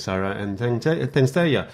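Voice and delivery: ordinary talk, nothing marked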